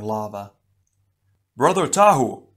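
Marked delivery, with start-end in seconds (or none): 1.6-2.5 loud, high urgent call; a brother calling from afar. Acted. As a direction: shout across the cavern to him. Onua calls out across cavern.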